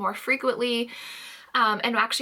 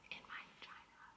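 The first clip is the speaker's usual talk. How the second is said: sarcastic whisper; breathy aside, dry and sarcastic; 4 semitones higher, 11 dB quieter, faster than usual. Real, not acted. Sarcastic aside about pains.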